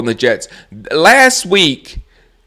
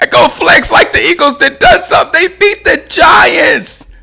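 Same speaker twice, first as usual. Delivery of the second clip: shouted rant; 12 semitones higher than usual. Real, not acted. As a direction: yell at them in disbelief. Ranting at Eagles fans.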